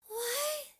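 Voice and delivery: very high, squeaky appeal; timid high calls, spaced apart; little child character. A narrator's reading. Lal appealing to the shouting teacher, high squeaky voice.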